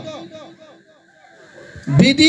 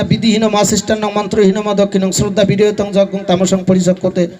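chanted shloka, a little lower and steady; Sanskrit recitation; 5 semitones lower than usual. Acted. Preacher to the gathering, reciting Gita shloka. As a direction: intone the verse like scripture.

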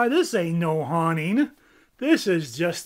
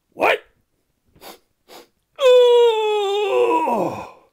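loud high yelp; shocked cry; 12 dB louder than usual. Acted. Bird crying out at the mess.

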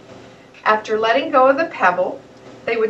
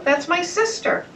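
mild yell; 8 semitones higher than usual. A narrator's reading. Toto defending his sister after the pebble, mild yell. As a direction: protest on his sister's behalf. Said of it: "yelled Toto".

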